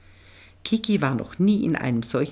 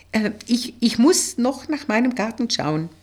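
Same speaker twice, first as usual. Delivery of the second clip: higher-pitched, stumbling excuse; raised voice pitch, holding someone back; hesitant putting off; 7 semitones higher, 4 dB louder than usual. A narrator's reading. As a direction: stall them with a hesitant excuse. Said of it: "bremste Kiki".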